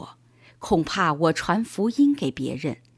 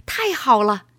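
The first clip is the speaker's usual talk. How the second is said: higher, louder burst of triumph; excited shout; 6 semitones higher, 5 dB louder than usual. A narrator's reading. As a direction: cry out in delight at the finish. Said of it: "他大叫". (兴奋地大叫)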